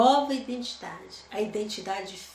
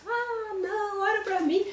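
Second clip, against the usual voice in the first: higher, pleading voice of a lazy believer; mock whiny plea; 11 semitones higher than usual. Pastor mimicking a believer who wants prayer done, whiny. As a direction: whine it like someone who won't pray. People asking pastor to pray.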